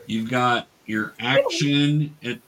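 ordinary talk

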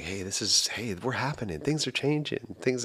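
softly voiced, hushed gentle murmur. Host retells the first gentle part of the poem, hushed. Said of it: "gentle and like".

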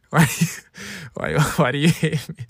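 breaking into laughter mid-imitation; laughing. Imitating mom asking why they hate her, laughing through it. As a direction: try the mock guilt trip and crack up.

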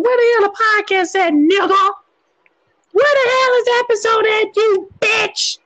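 pushy fan calling him out; demanding fans. Retold or imitated. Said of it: "I done had a couple people come up to me like".

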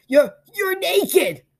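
high, loud, drawn-out stammer; astonished. A narrator's reading. Peter staring at his unclothed friend, astonished. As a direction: stumble in disbelief, then soar.

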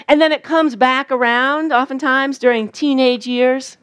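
ordinary delivery

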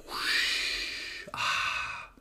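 breathy imitated gust of wind; whoosh of wind. Describing the movie's hair scene, breathy wind whoosh. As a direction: blow it like a breeze through hair.

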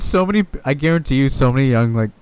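usual speaking voice

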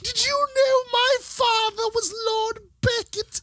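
very high, squeaky British toff; mock aristocratic British. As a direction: put on a falsetto upper-class British voice. Parodying a rich London aristocrat.

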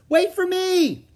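high, urgent holler; piercingly high shout; loud voice. A narrator's reading. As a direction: call out loudly to stop someone leaving. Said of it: "came a loud voice".

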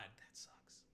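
slow, unvoiced whisper. Real, not acted. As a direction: breathe it out, let down. Disappointed aside.

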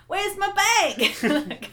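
very slow, high, laughing English accent attempt; a failed, high stab at a Cockney voice; bad English accent. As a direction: fumble a London accent while giggling. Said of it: "Sorry, that was really bad".

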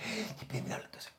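breathless near-whisper as the air runs out. Shown as a demonstration. Demonstrating a speaker who runs out of air, fading whisper. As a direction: voice dying away with no breath left. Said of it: "terminar de hablar con poco aire".

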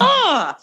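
high-pitched "ah!" of alarm; short, high cry of mock panic; mock scream. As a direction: yelp as if the game just beat you. Reacting to an impossible game level.

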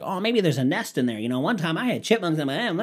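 high-pitched silly know-it-all; mocking imitation. People guessing there's a nest, mockingly imitated. Mimicking people offering useless theories.

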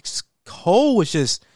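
ordinary talk, nothing marked

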